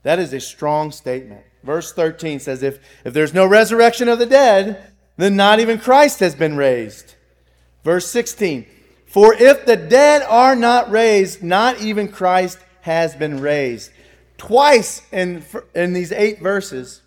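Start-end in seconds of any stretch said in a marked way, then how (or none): 2.6-7.1 loud, ringing scripture read. Real, not acted. Reads scripture verse aloud. 9.0-13.9 loud, slow scripture read. Real, not acted. Reads verse sixteen aloud.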